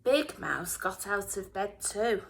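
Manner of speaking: usual speaking voice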